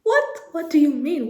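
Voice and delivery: mock shock. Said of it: "And I was like".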